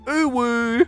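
high-pitched drawn-out coo; cutesy squeal. Acted. Reads out a cutesy emoticon.

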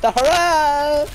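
loud, high, drawn-out hurrah; excited cheer. Acted. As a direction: belt out the cheer slowly. Third take, loud and high.

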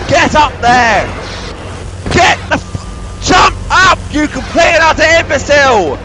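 high-pitched angry yelling; building into a raging insult; furious exasperation. Real, not acted. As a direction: lose your temper at a stubborn game character. Pleading turns to yelling at character.

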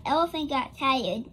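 ordinary delivery, nothing marked

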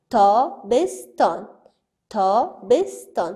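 تابستان said slowly, split into its syllables. Shown as a demonstration. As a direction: spell out تابستان slowly, tapping each syllable. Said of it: "توسط شمردن انگشتای خود هجا کنیم". (تابستان را آهسته هجا به هجا بگو)

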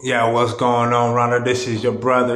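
normal talking voice

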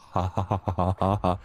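deep-voiced laugh. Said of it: "Kako se smiju osobe s dubokim glasom?".

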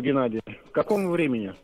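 ordinary delivery

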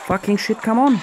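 sudden high angry yell; real frustrated swearing at the screen; angry outburst. Real, not acted. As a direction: blurt it out in real rage. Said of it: "I'm so angry right now".

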